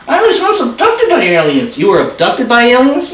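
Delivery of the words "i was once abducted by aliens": high, whiny puppet voice; stubborn little dummy refusing; squeaky ventriloquist dummy. Acted. Dummy tells joke.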